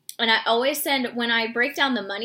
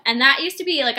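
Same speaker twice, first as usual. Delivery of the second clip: ordinary talk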